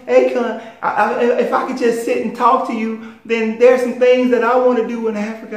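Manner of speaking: high, loud pleading wail; mocking whine. Commenters pleading with [name] for help in Africa, high mocking whine. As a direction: beg the star pitifully in a high mock whine, rising at the end. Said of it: "So don't sit whining".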